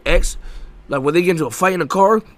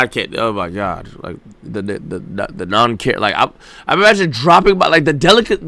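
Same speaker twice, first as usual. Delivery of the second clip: ordinary delivery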